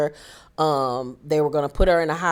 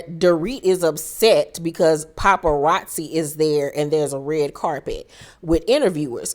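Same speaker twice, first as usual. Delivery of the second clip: normal talking voice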